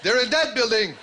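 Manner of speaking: calling out. Retold or imitated. Mountie spotting the gunmen, shouting an alarm. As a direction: raise the alarm across the hill.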